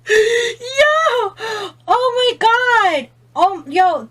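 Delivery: high, loud yelp of pain and laughter; laughing cry out. Real, not acted. Vlogger yelling as the eyeliner burns her eyes, high laughing cry.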